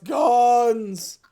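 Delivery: drawn-out, loud exclamation; hammed-up big reveal; excited exclamation. Excited reveal of wall of guns.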